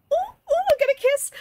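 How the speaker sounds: very high, loud giddy squeal; excited squeal. Real, not acted. Player thrilled the characters will kiss, squealing.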